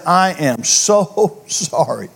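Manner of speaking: very slow, drawn-out, earnest apology; devastated apology. Acted. Friend who wrecked your car to its owner, devastated apology. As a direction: draw out each word, imitating heartfelt regret. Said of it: "they would be somewhat devastated".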